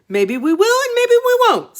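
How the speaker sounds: very high, loud, sing-song taunt; teasing dragon head. Acted. Dragon head Snide teasing the boy, very high sing-song taunt. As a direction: tease in a squeaky sing-song. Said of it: "said Snide".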